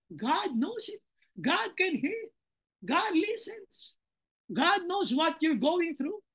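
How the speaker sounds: slow, softened and lifted in pitch; soft reassurance. Real, not acted. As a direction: gently reassure them, drawing each phrase out. Softly reassuring the congregation about God.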